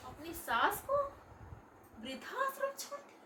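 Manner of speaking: high, aghast echo of the word; stunned disbelief. Acted. Mother hearing her daughter left mother-in-law, high aghast echo. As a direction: gasp the words back in disbelief.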